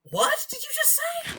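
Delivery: high, slow, incredulous question; stunned disbelief. Retold or imitated. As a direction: ask it as if you can't believe your ears. Retells her stunned reply.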